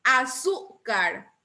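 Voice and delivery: Azúcar slowly with a punched ZÚ; Azúcar, slow, middle syllable emphasized; stress on Azúcar's middle syllable. Shown as a demonstration. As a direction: hit ZÚ, then drop off on car in Azúcar. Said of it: "Esa sí es grave".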